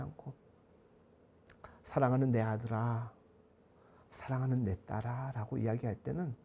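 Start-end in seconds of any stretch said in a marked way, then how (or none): 1.8-5.3 put-on softer, lower loving address to a child; gently calm a child you could scold; calm loving parent. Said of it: "차분하게 이야기를". (차분하고 다정한 부모 목소리)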